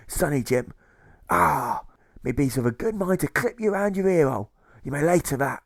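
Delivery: hammed-up old sea dog grumbling; salty old pirate, scornful; gruff old pirate jailer.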